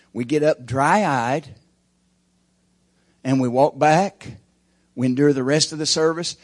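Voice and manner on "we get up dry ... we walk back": slow, loud, each word hit; preacher pounding the pulpit; emphatic preaching. Real, not acted. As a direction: spell out the hollow ritual slowly. Preaching at full force.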